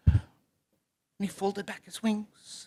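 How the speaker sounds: ordinary delivery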